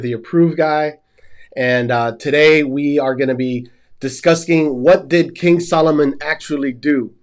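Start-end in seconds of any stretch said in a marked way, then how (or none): none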